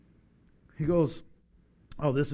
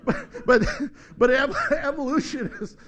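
high, loud laughter over the words; laughing; 5 semitones higher, 8 dB louder than usual. Real, not acted. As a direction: talk through a big laugh.

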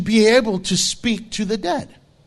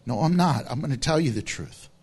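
usual speaking voice

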